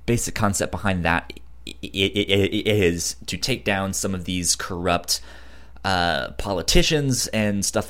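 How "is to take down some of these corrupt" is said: ordinary talk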